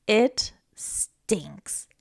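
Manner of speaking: ordinary delivery, nothing marked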